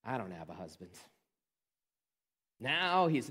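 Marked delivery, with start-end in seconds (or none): 0.0-0.9 low and soft, flat resignation; mild retold defeat, dropped low and quiet. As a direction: say it like there's nothing left to lose. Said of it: "she just kinda defeated says".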